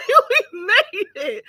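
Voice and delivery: high laughing burst; relieved laugh. Real, not acted. Finally arrived after the long drive, laughing in relief.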